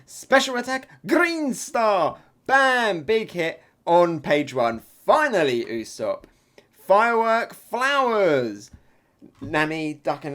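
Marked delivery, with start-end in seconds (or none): 0.1-3.0 high, loud battle shout; attack call. Acted. Usopp launching his attack, shouted move name. 6.9-8.7 loud, high, drawn-out move name; attack call. Acted. Usopp firing on his enemy, shouted attack name.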